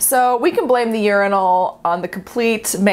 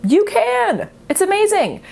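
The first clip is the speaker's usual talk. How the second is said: excited encouragement; 13 semitones higher than usual. Real, not acted. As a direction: pitch leaping high with delight. Climax of repeated encouraging refrain.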